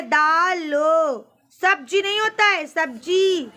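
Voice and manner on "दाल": दालो stretched out very slowly; slow teacher correction of दालो; careful correction. Shown as a demonstration. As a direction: drag out दालो to show the spelling. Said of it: "दाल ही नहीं होता है".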